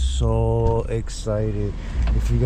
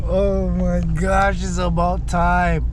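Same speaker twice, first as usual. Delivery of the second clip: slow, drawn-out, higher with delight; thrilled; 6 semitones higher, slower than usual. Real, not acted. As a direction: savour every word with joy. Thrilled at finally getting exhaust.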